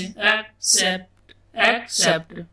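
एक्सेप्ट split slowly as "ek ... sept"; syllable by syllable. Shown as a demonstration. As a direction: break एक्सेप्ट into two separate sounds, ek and sept. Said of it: "जोड़ जोड़ के बनाते हैं". (एक्सेप्ट को तोड़कर, एक ... सेप्ट)

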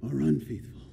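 soft, hesitant pondering; imitating an undecided thinker; thinking it over. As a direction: wonder it quietly to yourself.

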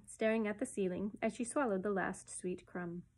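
ordinary delivery, nothing marked

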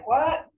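WHAT rising high at the end; high questioning lift on "What?"; didn't-hear question. Shown as a demonstration. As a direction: ask "What?" as if you couldn't hear. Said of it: "when somebody says something and you can't hear".

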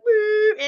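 loud, drawn-out hoot; imitated conch call. Shown as a demonstration. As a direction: blow a long woo as if into a seashell. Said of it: "what the fuck is seashell that you".

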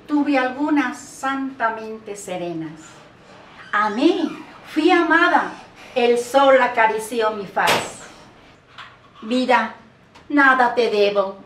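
ordinary delivery

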